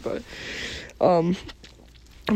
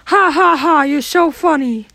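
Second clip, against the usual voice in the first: sarcastic laugh; 7 semitones higher, 3 dB louder than usual. Acted. Sarcastic fake laugh at host.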